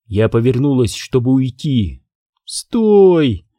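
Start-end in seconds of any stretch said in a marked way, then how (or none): 2.4-3.4 a raised, higher call of "Stop!"; understated acted yell; mild mock shout. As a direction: call her back, pitched up but barely louder. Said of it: "закричала она".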